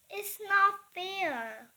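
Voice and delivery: Henry wailing after being sent to his room. Acted. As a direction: drag it out like a sulking child. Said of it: "wailed Henry".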